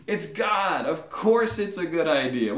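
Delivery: loud, high, exasperated insistence; incredulous exclamation. Acted. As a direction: insist on the blindingly obvious.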